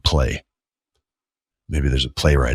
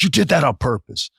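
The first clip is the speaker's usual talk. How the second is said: high-pitched retold accusation; angry confrontation; 9 semitones higher than usual. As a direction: act out getting in someone's face. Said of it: "I got right in his face".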